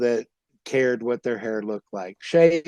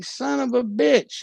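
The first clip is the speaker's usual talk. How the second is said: higher, slow retold curse; mock outrage; 9 semitones higher, slower than usual. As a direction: replay the brother's disbelief. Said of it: "And he was like".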